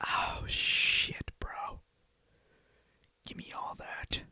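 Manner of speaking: hushed, breathy whisper. Real, not acted. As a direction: whisper like you might get caught. Sneaking the drink.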